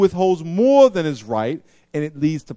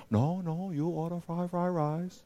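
much softer, gentle reply; soft answer; 10 dB quieter, slower than usual. Retold or imitated. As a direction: waiter calms him with a soft answer. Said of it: "A soft answer".

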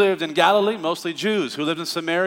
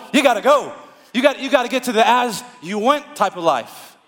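fervent; 6 semitones higher, 4 dB louder than usual. Real, not acted. Preacher building to climax.

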